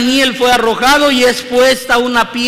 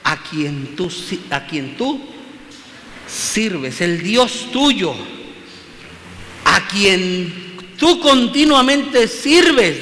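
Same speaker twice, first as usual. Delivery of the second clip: slow savouring; 3 semitones lower, 5 dB quieter, much slower than usual. Acted. Preacher slowly savours the king's words.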